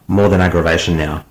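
ordinary delivery

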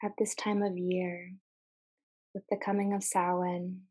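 calm, measured meditation voice with long pauses; guided meditation. Acted. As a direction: guide listeners gently, letting each phrase settle.

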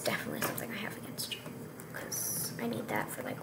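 fast whispered joke; whispered aside. Real, not acted. Gamer joking to viewers after losing a leg, quick whisper.

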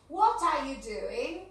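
normal talking voice

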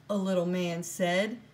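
usual speaking voice

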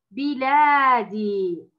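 drawn-out model word بلادي; slow model reading. Shown as a demonstration. Teacher models the word for Ola, slow. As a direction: read بلادي slowly, syllable by syllable. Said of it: "أول كلمة كلمة".

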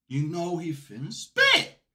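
slow, drawn-out hype voice with rising end; hype drawl. Shown as a demonstration. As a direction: stretch it out like a hype man.

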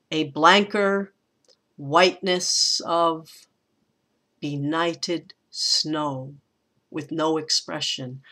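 slow gloomy poem reading, dying away; Poe-ish verse reading. Shown as a demonstration. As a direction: let each phrase hang, fading to a hushed, low close. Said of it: "Gets almost Edgar Allan Poe-ish there".

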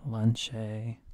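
"Lunch a" run together as lunch-ay; mock Spanish ending. Shown as a demonstration. Teacher invents Spanish for students, "Lunch a" as lunch-ay. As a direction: add a stretched "ay" to "Lunch a". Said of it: "just add a".

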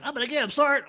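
put-on high, keen voice of an eager young officer; mock eager young officer. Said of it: "he was so cute".

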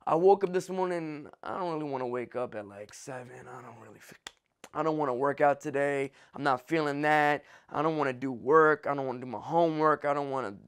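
low, soft, mumbled whining excuses; lazy whine. Retold or imitated. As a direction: act out a tired, lazy excuse-maker. Mocking a lazy excuse-maker.

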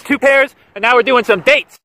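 normal talking voice

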